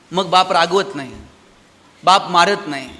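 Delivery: ordinary talk, nothing marked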